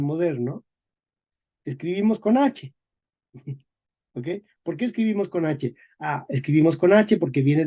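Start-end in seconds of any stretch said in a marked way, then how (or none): none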